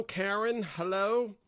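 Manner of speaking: put-on Karen stretched in a light New Jersey accent; a hint of New Jersey accent on a greeting. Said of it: "That was a New Jersey accent".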